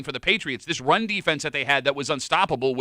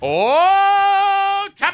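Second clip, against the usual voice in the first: loud, high long "Oh"; loud mock outrage at the cohost's pick; surprised shout; 8 dB louder, much slower than usual. Real, not acted. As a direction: call out in mock shock. Reacting to pick.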